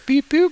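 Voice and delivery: two high little beeps; high-pitched sound effect; imitated beeps. Shown as a demonstration. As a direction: beep twice like placing markers. Marks imagined columns of air.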